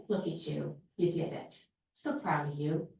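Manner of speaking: soft, hushed praise, almost breathed; gentle praise. Shown as a demonstration. Teacher praising a calmed child, soft and tender. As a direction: quietly tell the child you're proud.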